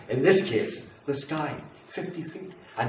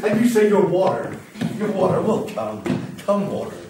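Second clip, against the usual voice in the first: higher and louder, taunting water; mock the water gleefully; manic taunt; 6 semitones higher, 4 dB louder than usual.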